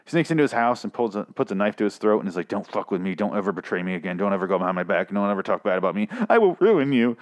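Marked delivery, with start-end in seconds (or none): none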